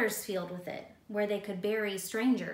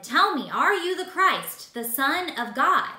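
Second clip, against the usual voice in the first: loud, higher, pressing demand; demanding high priest; 5 semitones higher, 9 dB louder than usual. Acted. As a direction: push him for an answer, loud.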